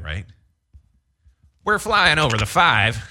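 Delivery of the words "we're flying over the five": high, loud chopper traffic report; traffic copter reporter. Pretend. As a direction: shout it over helicopter blades. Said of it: "you know the traffic copter gag".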